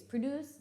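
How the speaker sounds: Produce as the verb, weak first syllable, stress on DUCE: pruh-DOOCE. Shown as a demonstration. Teacher showing students the verb form, pruh-DOOCE. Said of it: "So you say it differently".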